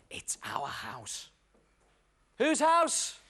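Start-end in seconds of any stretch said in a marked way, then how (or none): 0.1-1.3 whispered aside, slow; hushed whisper to the audience; conspiratorial whisper. Acted. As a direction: let the kids in on a secret.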